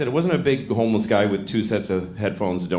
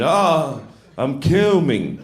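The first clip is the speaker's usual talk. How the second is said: a touch higher, slow awkward announcement; slightly weird announcing; 6 semitones higher, much slower than usual. Retold or imitated. Comic retelling blurting it to a new partner, slow awkward announcement. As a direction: say it a bit oddly, drawn out. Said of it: "So it came out weird".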